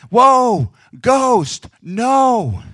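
high, loud, drawn-out yell; hammed-up cry at seeing a ghost; mock terror. Disciples terrified by ghost, replayed.

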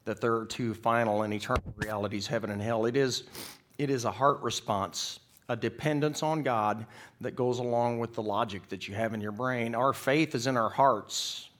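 loud, emphatic pulpit delivery; forceful preacher driving the point; impassioned preaching. Real, not acted. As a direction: declare it with conviction.

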